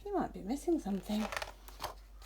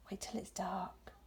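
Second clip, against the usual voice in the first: whisper; 9 dB quieter than usual. Acted. Father owl hushes eager son.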